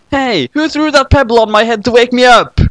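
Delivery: a hint of a grumpy boy; lightly voiced boy. Kyosuke woken by a pebble, mild grumble. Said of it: "Kyosuke grumbled".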